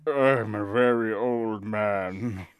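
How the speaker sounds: put-on slow, low creaky old man. Shown as a demonstration. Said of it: "Let me try and".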